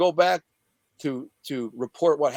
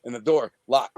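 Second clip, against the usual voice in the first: ordinary delivery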